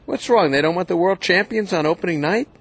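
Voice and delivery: ordinary talk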